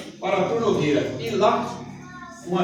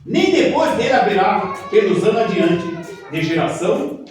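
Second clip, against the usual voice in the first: loud, slow, fervent Bible reading; preaching scripture; 7 semitones higher, 6 dB louder, slower than usual. Acted. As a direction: proclaim it like a prophet. Reads scripture with fervour.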